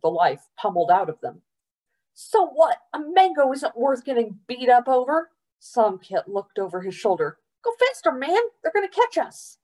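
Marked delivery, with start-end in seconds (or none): 2.1-5.3 high, agitated outcry; raised, frantic objection; exasperated protest. A narrator's reading. Friend arguing during a chase. 7.6-9.6 very high, panicky urging; frantic, squeaked-up warning; urgent alarm. A narrator's reading. Urging friend on during a chase.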